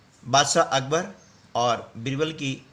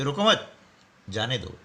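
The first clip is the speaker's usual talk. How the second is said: "रोको मत" as one unit, pause, lower "जाने दो"; pause after मत; 4 semitones lower than usual. Shown as a demonstration. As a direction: halt after मत, then let जाने दो fall. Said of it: "जाने के निर्देश हो चुके हैं". ("रोको मत" के बाद विराम, फिर "जाने दो")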